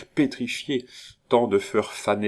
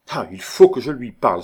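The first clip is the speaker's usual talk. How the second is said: loud, raised outburst; crying out; 4 semitones higher, 7 dB louder than usual. A narrator's reading. Young lover jumping up to confront her, crying out. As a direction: burst out with sudden resolve. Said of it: "s'écria-t-il".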